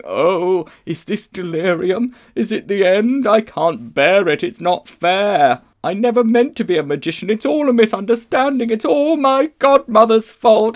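high, loud, self-pitying wail. A narrator's reading. Uncle Andrew lamenting in the darkness, loud wailing. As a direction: wail in protest as if all is unfair. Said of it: "It was wailing".

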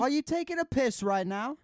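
high, loud chef rant; Gordon Ramsay. Retold or imitated. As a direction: join in as an incredulous raging chef. Joins in the chef's rant.

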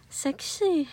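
soft far-off seductive murmur; sexy voice. Pretend. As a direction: breathe it out, trying to sound sexy. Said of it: "もうちょっとセクシーな声お願いします。".

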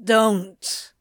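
warning demand, fighting a grin; mock-stern warning. A narrator's reading. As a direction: forbid it in a character voice. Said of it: "he demanded".

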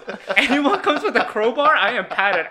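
loud, high, laughing boast; hyped mock bravado. Padded survivor daring anyone with a crowbar, hyped bravado. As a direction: crow it at full volume, playing it up.

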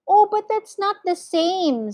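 high-pitched, flustered objection; anxious protest. A narrator's reading. Mister Gregg protests to the duck.

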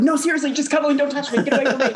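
high-pitched comic fending-off; mock alarmed protest. Co-host playing the guy who only wants cuddling, mock alarmed. Acting out man refusing advances.

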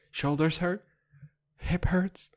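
normal talking voice

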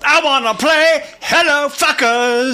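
high, slow rock singer voice; talking rock. Acted. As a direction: shout it like a rock frontman. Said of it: "I'll do rock voices".

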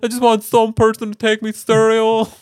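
hammed-up high self-pitying whine; whiny Al Gore. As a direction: wail it like an ignored man-child. Al Gore whining about being ignored.

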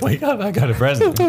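high, loud cartoon mouse squeal; squeaky mouse voice. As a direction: shriek it like a tiny excited mouse. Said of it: "He's like".

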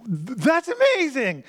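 awed wonder. Pretend. Marvelling at the miracle.

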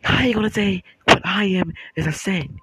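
high, loud indignant yelling; guy going off. Retold or imitated. Bi guy yelling at the girls, outraged. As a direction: yell it back at them, outraged. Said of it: "He was like".

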